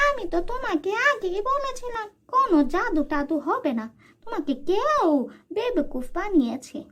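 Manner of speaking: high, scornful aunt voice; aunt mocking her fooled niece, high and scornful. Aunt mocks Anika.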